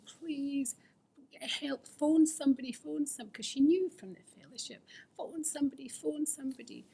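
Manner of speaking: high, urgent pleading; a frightened partner begging for help. Retold or imitated. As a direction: beg her to get help. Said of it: "And she was saying".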